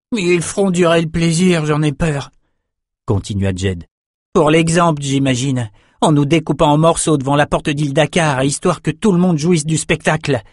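lightly voiced fretful prisoner. A narrator's reading. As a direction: dread the coming execution aloud. Voices Jed's gloomy prediction.

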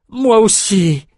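high, anxious quick agreement; frightened echo. A narrator's reading. Captive shepherd anxiously echoes his wife, frightened. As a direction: rush to agree, voice lifted in fear. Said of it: "lui fit écho Boyle".

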